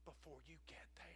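breathy hushed whisper. Real, not acted. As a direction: whisper it like a secret. Preacher whispers the secret.

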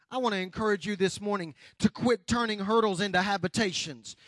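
ordinary delivery

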